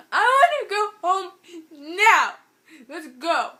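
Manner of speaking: very high, loud mock bawling; hammed-up tearful yell; imitated crying wail. Crying friend begging to go home, imitated wail. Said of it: "She's, she's freaking crying".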